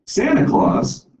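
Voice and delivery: high, slow, drawn-out astonishment; mock-surprised old woman; amused surprise. Old woman reacts to child.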